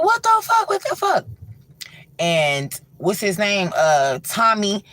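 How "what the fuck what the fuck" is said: high panicked scream; screaming in terror. Retold or imitated. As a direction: shriek it in horror. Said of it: "Kathy is screaming, Daniel is screaming".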